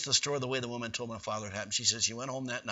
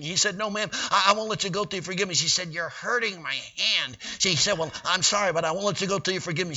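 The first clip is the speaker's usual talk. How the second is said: higher, louder storytelling; lively preached storytelling; 8 semitones higher, 8 dB louder than usual. Real, not acted. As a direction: drive the story along. Excited storytelling at full force.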